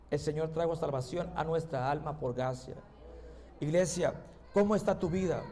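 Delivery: low, soft pastoral appeal; hushed and grave, letting pauses sit; solemn preaching. Acted. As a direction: bring it right down to question their souls.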